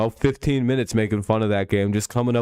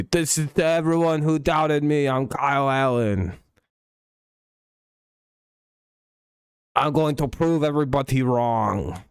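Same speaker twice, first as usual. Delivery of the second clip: higher, oddly earnest quarterback voice; imitating Kyle Allen; 4 semitones higher than usual. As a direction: play it like a defiant QB speech, slightly odd. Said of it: "a really odd way of speaking".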